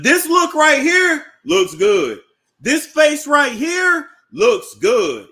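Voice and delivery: high, slow, loud praise; hyped praise. Real, not acted. Host hyping up Nene's new face for viewers, loud and slow. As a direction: gush over her face.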